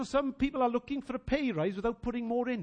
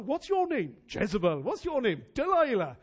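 mock exchange; 3 semitones higher, 7 dB louder than usual.

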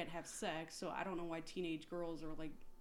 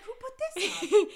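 incredulous shout; loud baffled outburst through laughter; 14 semitones higher, 16 dB louder than usual. Retold or imitated. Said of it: "I was like".

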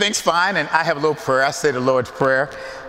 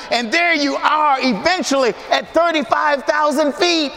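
higher, building preacher's climax; full-force preaching; 9 semitones higher than usual. Acted. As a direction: preach it out to the congregation.

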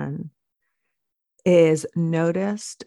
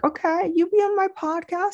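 put-on much higher, sing-song voice for a little child; baby talk to daughter; 13 semitones higher, faster than usual. Mom retells agreeing to her little daughter, high cooing. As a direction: coo it like you're humoring a toddler. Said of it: "I was like".

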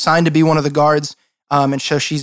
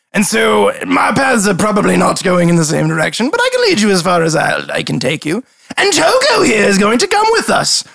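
raised, theatrical noble voice; grand grudging offer with high swoops; haughty lord; 4 semitones higher than usual. Acted. Lord offers to lead party to desert, haughty.

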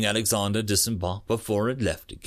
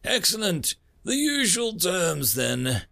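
smug alien leader; 7 semitones higher than usual. Acted. Alien leader pleased by surrender.

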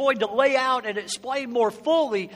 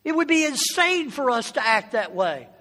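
normal talking voice

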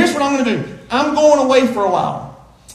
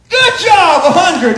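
excited praise; 7 semitones higher, 6 dB louder, slower than usual. Real, not acted. Praising child who answered.

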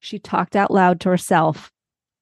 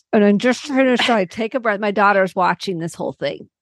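ordinary talk

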